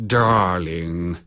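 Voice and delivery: a drawn-out, sneering endearment; mocking, superior sneer at a fumbling co-star. A narrator's reading. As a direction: sneer the word at her like a put-down. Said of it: "he sneered".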